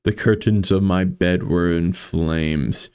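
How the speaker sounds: ordinary delivery, nothing marked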